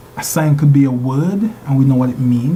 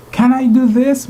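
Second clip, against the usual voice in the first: rising question; 8 semitones higher, 3 dB louder than usual. Shown as a demonstration. Lecturer demonstrating to students how a question rises. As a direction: pitch climbs high on the question. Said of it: "Your voice goes up".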